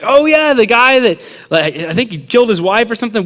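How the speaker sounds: loud, high-pitched mock recognition; playing along. Teen bantering along with a prank, loud and high. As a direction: jump in loudly with made-up gossip. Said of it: "like, bantering".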